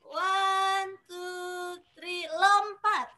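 high, slow coach countdown; gym coach. Acted. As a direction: count them into the jump like a coach. Counting kids into the jump. (hitungan pelatih senam yang lambat dan tinggi)

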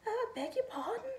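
very high squeaky apology; squeaking rabbit. A narrator's reading. Rabbit apologizes to the snowman, high squeak. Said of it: "squeaked the rabbit".

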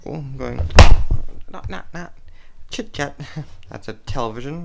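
usual speaking voice

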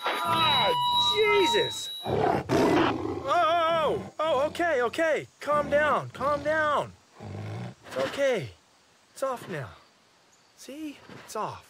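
high breathless panic settling down; panicked. Acted. Startled by something on him, high panic easing. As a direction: freak out, then talk yourself calm.

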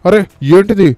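high-pitched startled exclamations; alarmed surprise. Pretend. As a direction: cry out, startled at a sudden crowd. Startled by a crowd pouring in. (కంగారుగా, ఆశ్చర్యంతో)

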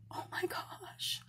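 a hushed gasp of wonder; whispered oh my gosh; awed whisper. Real, not acted. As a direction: breathe it out in a whisper. Awed over a pendant.

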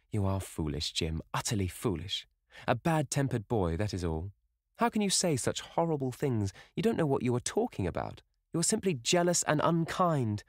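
high, lilting voice, gently scolding; reproachful young sister. Acted. Sister scolds her brother.

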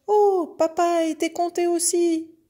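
very high, loud calf wail; calf crying out. A narrator's reading. Calf seeing papa counted too, high loud cry. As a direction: exclaim it high as an alarmed little calf. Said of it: "s'écria le veau".

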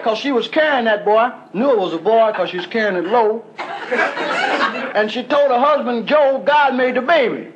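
rambling wino. Retold or imitated. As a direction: ramble like a street drunk. Wino impression.

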